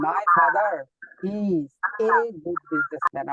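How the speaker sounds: my father is a linked, then a slow good businessman; linked rhythm. Shown as a demonstration. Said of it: "যেমন আমরা এভাবে পড়ি".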